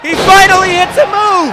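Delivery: high, loud play-by-play yell; excited shout. Real, not acted. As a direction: go wild over the big move. Commentator reacts to big move.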